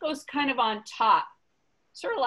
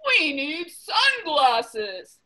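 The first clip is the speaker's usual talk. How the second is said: slow, firm and a bit louder; emphatic announcement; 4 semitones higher, 4 dB louder, much slower than usual. Acted. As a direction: lay out the plan slowly. Elephant announces his plan.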